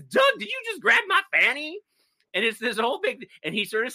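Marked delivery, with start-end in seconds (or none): 0.0-1.8 much higher, indignant shocked cry; shocked outrage. Retold or imitated. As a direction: play the startled woman who just got grabbed. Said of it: "slaps him and says".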